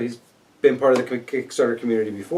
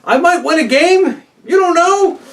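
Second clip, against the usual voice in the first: defiant boast; 5 dB louder than usual. Real, not acted. Teasing boast about winning.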